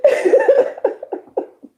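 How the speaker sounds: squealing giggle of joy; excited laughing squeal; high delighted laugh. Real, not acted. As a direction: giggle high and breathless with glee. Delighted laugh after news.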